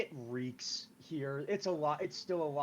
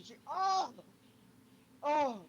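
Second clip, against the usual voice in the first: stung cry; 11 semitones higher, 4 dB louder, slower than usual. Acted. Salty rag stings her eye.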